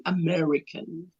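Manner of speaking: "American" said slowly with an American R. Shown as a demonstration. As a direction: curl the tongue for the R in "American". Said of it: "tongue needs to do a rolling function".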